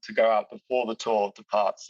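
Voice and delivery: usual speaking voice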